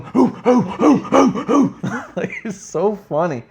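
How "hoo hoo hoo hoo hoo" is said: high, loud rhythmic hoo hoo; imitating Bailey barking for Roman; barking hoots. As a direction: chant like a barking fan. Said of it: "she's going".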